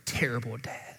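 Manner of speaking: hushed accusing whisper; devil's whisper. Retold or imitated. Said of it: "he will whisper in our ear".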